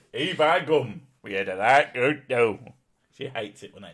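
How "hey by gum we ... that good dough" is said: hammed-up Northern English accent; mock northern English dialect voice. Shown as a demonstration. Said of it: "I do a flawless northern accent".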